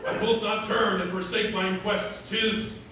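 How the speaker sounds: loud, declaimed mock-archaic stage voice; resolute masked hero. Hero telling Selina he must stay for London, declaimed. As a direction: declaim it like a hero refusing love. Acts the hero of his play.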